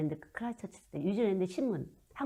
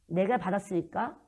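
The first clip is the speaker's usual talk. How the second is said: ordinary talk, nothing marked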